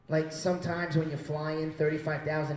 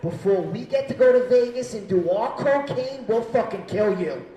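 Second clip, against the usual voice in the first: loud, raised mock menace; angry threat; 5 semitones higher, 9 dB louder than usual. Passengers warn pilot not to land, loud threatening. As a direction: threaten him as an angry mob.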